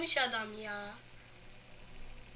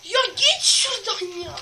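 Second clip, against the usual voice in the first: higher, loud irritated yell; angry shout; 6 semitones higher, 10 dB louder than usual. Real, not acted. Gamer yelling at a blocker in the game. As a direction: snap at the obstacle.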